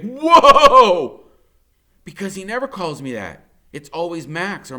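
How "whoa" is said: high, loud, laughing whoa; stunned whoa. A narrator's reading. Boy stunned when grandfather calls him son. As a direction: burst out in amazed disbelief.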